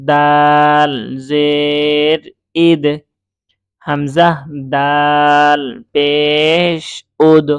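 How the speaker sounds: long held letter names, then "আব", "ইজ", "উদ" punched with an echo; qalqalah spelling drill. Shown as a demonstration. Teacher drilling learners, drawn-out spelling then "উব" with a bounced ending. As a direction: chant "জিম" and "দাল" slowly, bounce the end of "আজ" and "আদ". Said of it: "এই যে পড়লাম, এগুলো হচ্ছে কলকলার পড়ার ব্যবহার".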